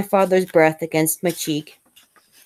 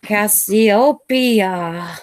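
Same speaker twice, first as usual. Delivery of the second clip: drawn-out, higher bad Italian accent calling Cassiopeia; father teasing his daughter in a hammed-up Italian accent; 4 semitones higher, much slower than usual. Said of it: "always in a very bad Italian accent".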